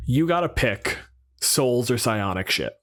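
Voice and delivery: normal talking voice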